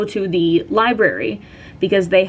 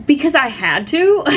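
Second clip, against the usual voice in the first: higher, rising retort as if obvious; incredulous retort; 6 semitones higher than usual. Retold or imitated. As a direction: answer back with a questioning lift at the end. Acted retort to a nosy question.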